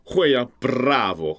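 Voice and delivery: Bravo with a slow trilled r; rolled r. Shown as a demonstration. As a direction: roll the r in Bravo, drawn out. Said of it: "你会弹舌吗？".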